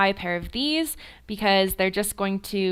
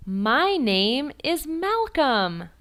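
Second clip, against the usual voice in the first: very slow, clear, higher model line; slow model sentence; 5 semitones higher, much slower than usual. Shown as a demonstration. Teacher modelling a sentence for repetition, slow. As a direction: say it slowly for the student to copy.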